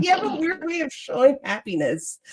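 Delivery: high, dry grandma remark; grandmother voice. Retold or imitated. Grandmother remarking on her tears, high and dry. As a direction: tease like a wry grandmother. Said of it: "She's like".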